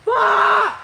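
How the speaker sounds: shouting. Real, not acted. Yelling into woods for echo.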